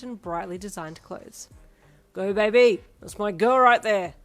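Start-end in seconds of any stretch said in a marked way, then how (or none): 2.1-4.1 proud dad yelling from the sidelines; loud cheering shout. A narrator's reading. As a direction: cheer at full volume for your girl. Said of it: "Darby shouted loudly as he cheered".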